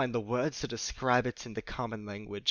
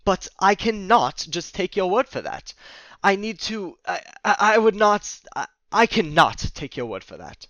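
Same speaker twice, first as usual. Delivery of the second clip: louder, higher denial; upset protest; 7 semitones higher, 9 dB louder than usual. Acted. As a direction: refuse to believe it. Dennis refuses the news.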